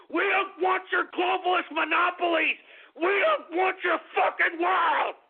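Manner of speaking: defiant, high-pitched yelling tirade; real outraged shouting; angry shout. Real, not acted. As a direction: bellow it at the enemy, furious. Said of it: "getting so goddamn angry".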